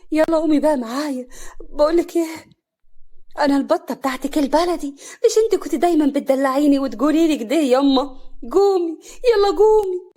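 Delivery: loud, high pleading wail, building; desperate daughter urging mother up; collapsing in grief. A narrator's reading. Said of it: "هنا بنيار". (بانهيار)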